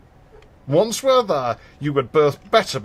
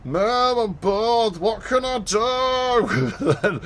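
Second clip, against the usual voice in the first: put-on high, drawn-out whiny child voice; mock whiny child nagging mum; whiny kid; 8 semitones higher, much slower than usual. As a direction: whine it like a bored little kid. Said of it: "With that intonation".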